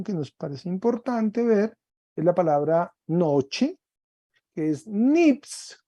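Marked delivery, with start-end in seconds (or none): 4.9-5.8 nips drawn out slowly and stressed; slow dictation. Shown as a demonstration. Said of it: "acento agudo". (nips, despacio y marcado)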